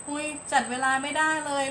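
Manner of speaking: flustered parent. Acted. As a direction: voice an overwhelmed parent's mild dismay. Voices a worried overwhelmed parent. (พ่อแม่บ่นอย่างลนลานเล็กน้อย)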